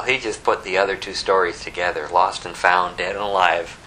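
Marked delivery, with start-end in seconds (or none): none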